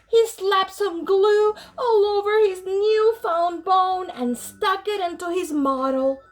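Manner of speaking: plain reading voice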